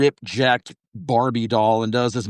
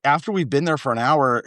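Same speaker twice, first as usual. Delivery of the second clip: ordinary talk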